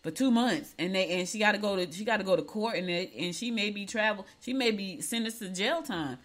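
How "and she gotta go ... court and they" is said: ordinary talk, nothing marked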